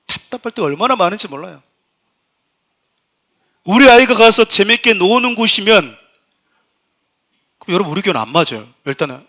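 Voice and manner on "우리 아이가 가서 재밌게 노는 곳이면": 크고 높게 또박또박 느리게; 답답한 마음에 목소리를 높여; 답답해서 외치는 설교. Real, not acted. 학부모 기준을 답답해하며 강조. (느리게 힘주어 외치듯)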